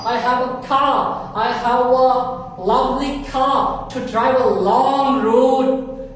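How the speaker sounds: slow model sentence. Shown as a demonstration. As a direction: say "I have a lovely car" slowly. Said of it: "slow model sentence".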